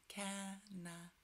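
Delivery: "Canada" slow and soft, Ca-na-da; syllable by syllable. Shown as a demonstration. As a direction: model "Canada" gently in three separate beats. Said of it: "Can you say Canada?".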